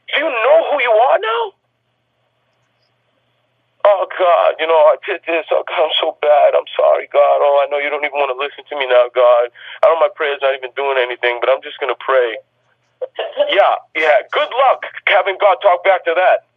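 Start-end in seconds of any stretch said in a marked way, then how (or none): 3.8-12.4 low, moaning parody of a defeated believer; mocking whiny prayer. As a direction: mope through it like you expect no answer.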